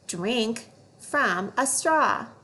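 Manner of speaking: "Drink from a straw" drawn out slowly; slow fluency read. Shown as a demonstration. Teacher reading a fluency phrase slowly.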